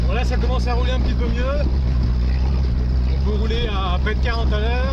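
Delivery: on a bumpy road. Real, not acted. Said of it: "Ça secoue un peu la voiture".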